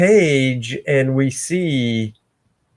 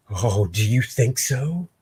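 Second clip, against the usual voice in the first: soft taunt; 3 semitones lower, 7 dB quieter than usual. Acted. Villain taunt, first take.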